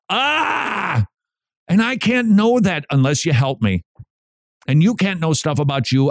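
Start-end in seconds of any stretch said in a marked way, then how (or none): 0.1-1.1 high drawn-out "ah"; put-on cry of outrage; mock angry cry. Host mimicking what their face said, mock angry cry.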